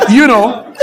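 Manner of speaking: usual speaking voice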